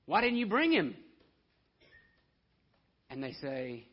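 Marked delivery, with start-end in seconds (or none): 0.0-1.0 furious high shout at the officers; livid demand. Retold or imitated. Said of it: "The Pharisees are livid".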